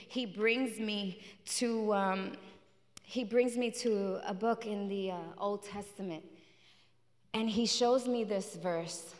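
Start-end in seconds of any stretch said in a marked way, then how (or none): none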